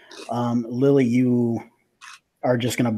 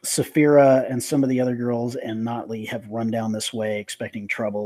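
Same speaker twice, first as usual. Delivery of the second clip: ordinary delivery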